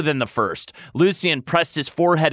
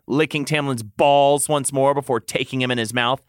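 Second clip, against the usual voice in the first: ordinary delivery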